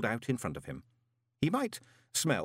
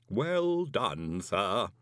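unruffled servant in a put-on voice; measured, formal butler reply; Igor manservant; much slower than usual. A narrator's reading. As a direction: humour the guest with deadpan deference. Igor servant answers visitor.

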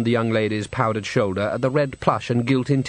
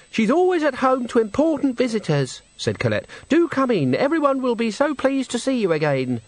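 put-on happy young woman; 12 semitones higher than usual. Girl at the brothel door welcoming Granny, high and happy. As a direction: greet her like a delighted young hostess. Colette voiced higher.

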